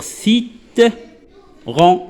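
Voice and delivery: "citron" broken into slow parts, C as s; syllable by syllable. Shown as a demonstration. Teacher reading the example to pupils, slowly sounded out. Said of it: "je l'ai prononcé comme si".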